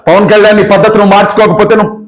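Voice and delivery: ordinary delivery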